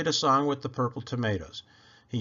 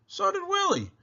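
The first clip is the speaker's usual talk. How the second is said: playful high. A narrator's reading. Playful repeat.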